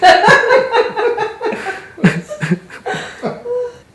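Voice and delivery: high helpless burst of laughter; real laughter. Real, not acted. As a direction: crack up laughing mid-reading. Breaks up reading hate mail.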